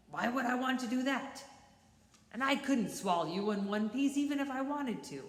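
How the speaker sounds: scoffing. Acted. Coyote scoffs.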